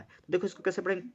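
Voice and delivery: ordinary talk, nothing marked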